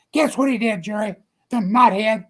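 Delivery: high and loud, fed up; exasperated. Retold or imitated. Chuck venting to Jerry about the brother-in-law, exasperated. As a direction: spit out his disgust.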